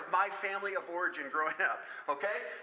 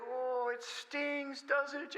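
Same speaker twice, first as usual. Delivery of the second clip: put-on soothing a toddler; 8 semitones higher, much slower than usual. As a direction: coo it gently to a crying little girl.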